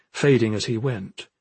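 plain reading voice

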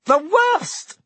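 very high, eager question; alive with curiosity. A narrator's reading. Landlady questions the Minister's hint, alive with curiosity. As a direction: pounce on the news with curiosity. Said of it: "her voice alive with curiosity".

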